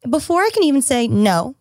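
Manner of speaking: usual speaking voice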